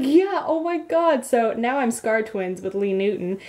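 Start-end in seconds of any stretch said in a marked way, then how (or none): 0.0-1.3 high, drawn-out thrilled agreement; match her squeal with your own; excited reply. Retold or imitated. Retelling own answer to Lee, re-enacted excitement.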